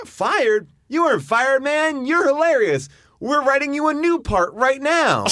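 high, gushing you're hilarious; eager high guy. Retold or imitated. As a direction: pump him up in a high excited voice. Enthusiastic guy reassures him.

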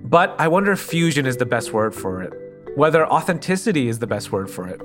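ordinary talk, nothing marked

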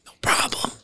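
breathy whisper; whispered reply. Retold or imitated. Trainers to the director on set, whispered. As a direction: whisper it confidently. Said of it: "they're like".

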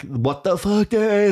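slow, high burst of frustration; exasperated burst. Acted. Parent at the end of their tether, exasperated. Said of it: "that little teeny burst of, like".

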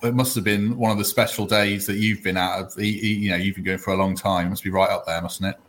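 normal talking voice

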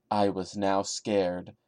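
ordinary talk

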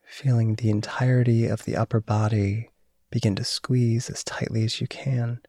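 guided meditation. Acted. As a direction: guide them into calm and sleep. Leads a body relaxation.

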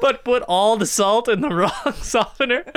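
can't stop laughing while telling it; real giggling mid-sentence. Real, not acted. Laughing through funny story.

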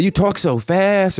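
mock a girlfriend's complaint, stretching it out; imitating girlfriend's complaint. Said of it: "And she like".

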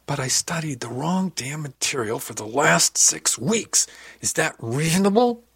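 slow, high, loud protest; frustrated outburst. Acted. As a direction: build to an exasperated cry. Upset protest climax of argument.

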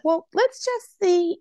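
high, drawn-out mock coaxing; imitating a coaxing agent. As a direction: stretch it out, high and wheedling. Retelling agent's coaxing reply.

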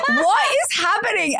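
flabbergasted, voice up high; amazed disbelief. Retold or imitated. Actress recounting a man recognizing her, stunned. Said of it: "And I was like".

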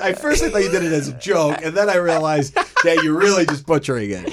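laughing through the words; half-laughing complaint. Real, not acted.

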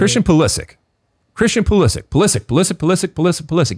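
"Pulisic" drilled over and over, LIS stressed each time; stress on second syllable. Shown as a demonstration. Host drilling co-host on the accent, "Pulisic" stressed on LIS. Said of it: "Second syllable".

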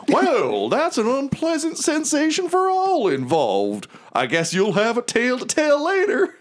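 very high, stretched-out squeaky voice; Lulu. Acted. Mammoth with devil hanging off its tail, high squeaky remark. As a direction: pitch it way up for a chatty creature. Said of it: "And Lulu's like".

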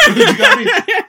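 squealing with laughter, high and loud; laughing. Real, not acted.

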